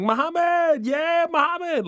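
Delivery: high excited call across the street; hammed-up fan calling from across the street; fans yelling his name. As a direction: shout the name like a cheering fan. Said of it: "people ... yelling Muhammad from across the street".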